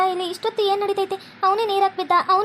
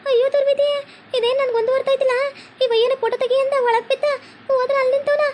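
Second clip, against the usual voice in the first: high-pitched crying wail over her fallen husband; wailing lament; 7 semitones higher than usual. Acted. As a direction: wail in disbelief, voice high and breaking. Wife wails at his cries.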